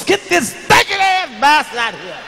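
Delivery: mock angry yell. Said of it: "Two-year-old".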